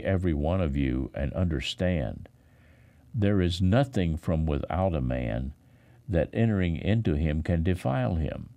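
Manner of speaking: ordinary talk, nothing marked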